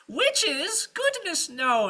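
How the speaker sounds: very high, loud fluttery denial; falsely innocent witch. Acted. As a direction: deny it in mock shock.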